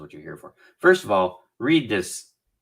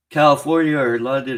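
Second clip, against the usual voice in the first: yawning; 6 semitones higher, 8 dB louder than usual. Real, not acted. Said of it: "excuse me for yawning".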